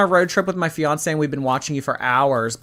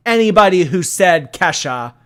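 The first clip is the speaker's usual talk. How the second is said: loud, raised-pitch announcement; indignant call-out; 5 semitones higher, 8 dB louder than usual. Real, not acted. Streamer calling out chat for naming [name], indignant. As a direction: call out the offenders loudly. Mock-scolding chat over song.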